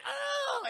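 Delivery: long, very high comic wail; mock donkey cry. Streamer imitates the donkey hit by a poker, high wail.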